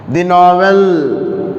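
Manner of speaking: The novel, stretched slowly, with NOVEL high and emphatic; emphasis on NOVEL. Shown as a demonstration. Teacher showing an article before the novel, stressing NOVEL slowly. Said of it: "you are putting emphasis on the novel".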